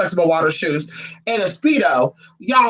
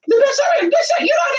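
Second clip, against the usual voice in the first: shrieking call-out. Acted. Calling out rivals online with a high shriek.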